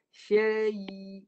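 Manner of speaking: "Syai'" drawn out on one note. Shown as a demonstration. Teacher shows where ya is formed, drawn-out demo. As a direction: hold the y at the end of "Syai'". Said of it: "huruf ya tengah lidah".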